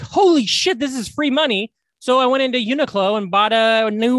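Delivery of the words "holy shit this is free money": excited. Retold or imitated. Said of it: "And so I was like".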